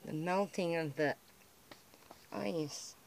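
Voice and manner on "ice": ordinary talk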